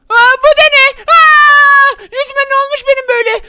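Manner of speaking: high, loud shocked cry; shocked gasp. Acted. Seeing his painted face, high loud shocked cry. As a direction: gasp at your own face.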